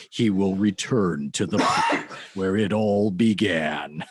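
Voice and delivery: slow, deep, portentous trailer narration; movie trailer voice. Retold or imitated. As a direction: draw it out low like a trailer voiceover. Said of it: "why are you saying it like that".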